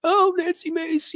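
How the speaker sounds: very high, drawn-out mocking wail; mock yelling. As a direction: mimic someone screaming in a silly high voice. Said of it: "yell at her and scream at her".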